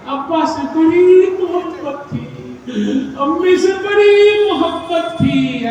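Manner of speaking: slow, high, drawn-out show of grief; mock boastful son. Son boasting love at funeral.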